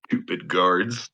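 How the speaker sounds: ordinary talk, nothing marked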